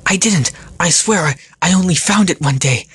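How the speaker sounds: high, loud frantic denial; frightened pleading. Acted. Ratik denies creating the rift, pleading frantically. Captive demon pleads with the Phantom.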